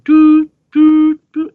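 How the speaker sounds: high loud beeping drone; dial tone imitation. Reading a manga panel, imitating the dial tone. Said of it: "it's going".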